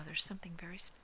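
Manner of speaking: ordinary delivery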